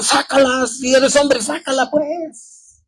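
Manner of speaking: high, taunting mock provocation; retold challenge. Angry driver daring the motorcyclist, mock taunt. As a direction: throw the dare at the biker, imitating him. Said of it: "y le dijo".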